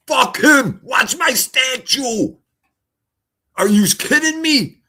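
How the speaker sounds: high, loud outraged yell; shouting. Retold or imitated. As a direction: yell it in disbelief. Retold friend yelling at rescuers.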